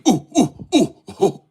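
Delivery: high, playful monkey hoots "ooh, ooh"; monkey noises. Pretend. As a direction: hoot like a cheeky monkey. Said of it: "I speak monkey".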